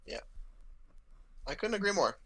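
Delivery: ordinary delivery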